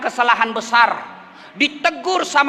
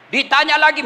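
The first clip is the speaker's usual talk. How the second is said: ordinary talk, nothing marked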